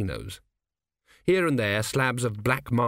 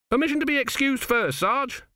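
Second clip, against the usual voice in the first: much higher, anxious lad's voice; young recruit. Acted. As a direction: ask it like a soldier needing the toilet. Narrator voices Autocue.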